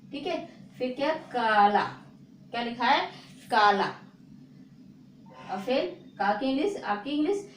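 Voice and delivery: ordinary talk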